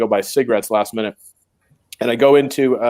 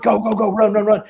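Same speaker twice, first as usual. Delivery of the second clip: higher, urgent go go go; urging on; 8 semitones higher than usual. Retold or imitated. Driver dropping him at the beach, urging him to run. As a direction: hurry him along. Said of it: "he's like".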